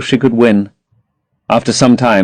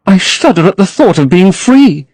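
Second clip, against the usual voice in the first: put-on higher, dreamy young girl; Sybil in love; 8 semitones higher than usual. A narrator's reading. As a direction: gush about her lover to her brother.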